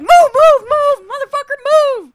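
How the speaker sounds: high, loud police barking; shouted orders. Retold or imitated. SWAT officers storming up the stairs, shouted orders. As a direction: yell it like a SWAT team clearing a building.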